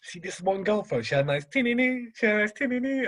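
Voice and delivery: high, singsong retold slang; high-pitched mimicry. Retelling a friend talking about a girl, high mimicry.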